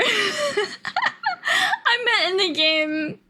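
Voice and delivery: high laughter into a slow, tearful clarification; laughing plea. Real, not acted. Streamer clarifying a joke to viewers, laughing helplessly. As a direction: plead your innocence through helpless laughter.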